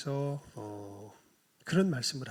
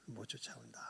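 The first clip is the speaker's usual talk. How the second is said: whispered; 4 semitones lower, 16 dB quieter than usual. Acted. Jesus repeating it quietly.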